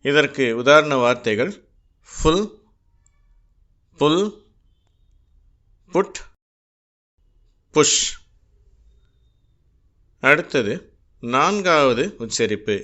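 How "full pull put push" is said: ஃபுல், புல், புட், புஷ் with a short u sound; short u demo. Shown as a demonstration. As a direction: say each word alone with a short u. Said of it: "உ என்ற உச்சரிப்பு". (யூ எழுத்தை உ ஒலியாக உச்சரித்தல்)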